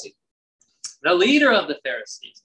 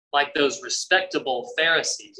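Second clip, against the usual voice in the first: ordinary delivery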